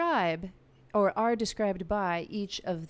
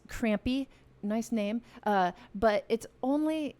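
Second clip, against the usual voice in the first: ordinary talk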